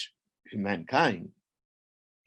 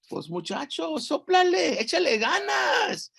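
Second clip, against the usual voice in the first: very high, rousing ant voice; urging on; 3 dB louder than usual. Acted. Ant urging the boy to blow the concha, spirited. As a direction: egg him on, pitch climbing high.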